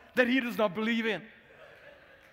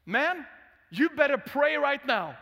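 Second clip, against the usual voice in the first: loud, higher taunt; drunk challenge; 4 semitones higher, 6 dB louder than usual. Retold or imitated. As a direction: bark it at the pastor like a drunk. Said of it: "and then he says".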